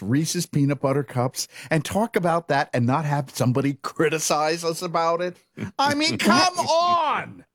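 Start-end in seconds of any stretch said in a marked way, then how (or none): 5.8-7.5 exasperated. Real, not acted. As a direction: throw up your hands, come on!. Exasperated end of rant.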